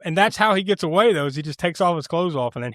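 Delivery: normal talking voice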